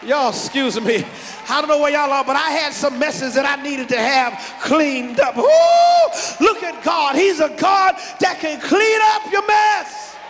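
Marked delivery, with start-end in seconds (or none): 5.4-10.2 high, loud, slow preacher's climax; preaching at full force. Real, not acted. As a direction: lift the church with it.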